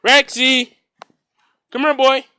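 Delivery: loud, high shout across the yard; shouting for Rex across the yard; calling out. Real, not acted. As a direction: yell for the dog to come.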